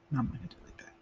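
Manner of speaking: breathy whisper, almost no voice; whispered aside. Acted. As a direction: let it slip out under your breath. Quiet aside ending the point.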